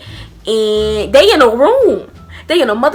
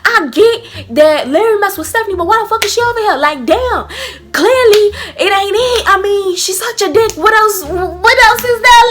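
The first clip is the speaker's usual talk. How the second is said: going off; 8 semitones higher than usual. Retold or imitated. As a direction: let the outrage pour out. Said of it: "I was going off".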